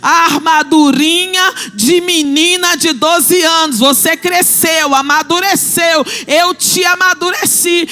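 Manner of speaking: loud, rising preacher's appeal; preaching at full force. Acted. Preacher urging the women to grow up, loud and fervent. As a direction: press the point on the congregation.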